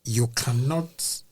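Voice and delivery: ordinary talk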